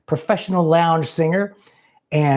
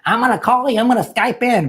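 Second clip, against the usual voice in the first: mock eager viewers; 8 semitones higher than usual. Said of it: "Everyone's like".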